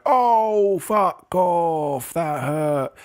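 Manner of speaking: high, drawn-out mock cry of pain; pained outburst. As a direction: retell it as a wounded groan, voice sliding down. Acting hermit someone landed on.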